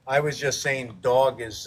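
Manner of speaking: ordinary talk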